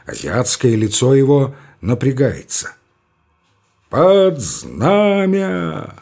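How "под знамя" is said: higher, very slow, ringing; sharp metallic command. Acted. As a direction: cut the air like a parade colonel. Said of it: "резким металлическим голосом".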